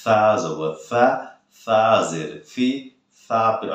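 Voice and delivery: slow, drawn-out সা জবর সা, সা জের সি; taking time. Shown as a demonstration. Teacher showing students to take time, slow drawn-out spelling drill. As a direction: stretch each সা out unhurriedly. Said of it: "সময় নিয়ে পড়তে হবে".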